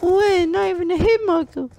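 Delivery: very high squeaky voice; high squeak. Real, not acted. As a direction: squeak out the disbelief. Shocked at missed shot.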